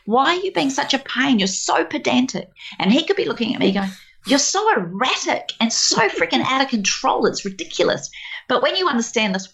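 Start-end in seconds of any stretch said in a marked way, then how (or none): 0.0-2.5 higher-pitched, indignant mock protest; exasperated complaint. Wife complaining about her husband's tidying, mock exasperation. 4.3-8.1 lightly acted higher-pitched reproach; mock exasperation. Imagined husband complaining about his wife's chaos, mildly exasperated.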